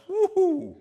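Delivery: high, loud sarcastic whoop; a sarcastic shout of woo-hoo; mock cheer. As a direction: cheer it like a fan at a game. Preacher cheering ironically.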